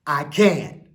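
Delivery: put-on loud, slow call for the kids to echo; a rousing chant line for young viewers; loud call to repeat. Shown as a demonstration. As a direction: say it big so they shout it back.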